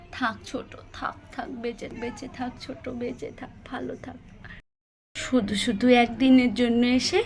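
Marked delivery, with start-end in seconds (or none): 0.1-4.2 much softer, a hushed, half-whispered blessing; soft emotional farewell whisper. Acted. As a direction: bless her under your breath, choked up. Elder sister blessing at the parting.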